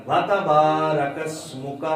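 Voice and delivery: slow وَتَبَارَكَ اسْمُكَ with با held long; long vowel. Shown as a demonstration. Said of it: "واو کو تا کے ساتھ نہیں ملانا". (وَتَبَارَكَ اسْمُكَ، با کو لمبا کر کے)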